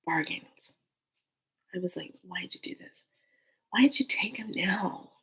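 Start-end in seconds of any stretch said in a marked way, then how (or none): 2.2-5.1 whispered, aching question; hushed pleading. Retold or imitated. Mourner bargaining over his death, hushed pleading. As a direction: plead under your breath, almost voiceless.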